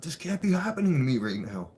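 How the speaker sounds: retold frantic outburst; panicked disbelief. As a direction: spiral into panic. Anxiety freak out in court.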